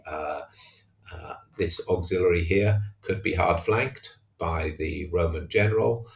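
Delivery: normal talking voice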